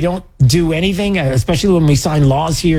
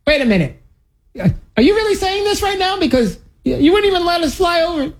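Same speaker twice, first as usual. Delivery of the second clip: much higher, incredulous protest; mock indignant disbelief. Host imagines confronting Macron, high incredulous mock protest. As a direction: squeak out an outraged how-dare-you. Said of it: "I would be like".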